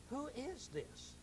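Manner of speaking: breathless amazed murmur; awed whisper. Acted. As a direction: ask it in hushed wonder. Amazed crowd asking.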